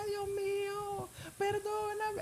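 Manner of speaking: high, drawn-out imitated wail; crying out. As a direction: re-enact a pleading cry, very high but held back in volume. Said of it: "yo gritaba. Yo decía".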